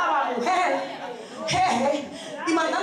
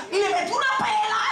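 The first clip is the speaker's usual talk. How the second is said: high-pitched, quick punch on "pela"; storyteller's high-pitched emphatic hit; emphatic punchline; 6 semitones higher than usual. Retold or imitated. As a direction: deliver "pela" as a raised punchline. Punchline repeated more slowly for emphasis.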